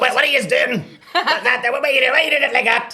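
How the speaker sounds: hammed-up outrage, words tumbling out; mock outraged shout. Dead grandmother yelling at the men nailing her coffin, high fast mock outrage.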